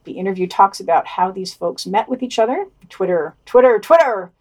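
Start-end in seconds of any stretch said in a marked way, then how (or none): 2.9-4.3 louder, wry repeat of Twitter; mocking repetition. Host sneering that they met on Twitter, louder, mocking. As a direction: roll your eyes on each Twitter.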